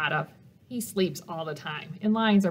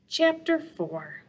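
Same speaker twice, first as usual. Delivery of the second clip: put-on reading to children. A narrator's reading. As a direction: read it gently to little listeners, asking them questions. Reads picture book to children.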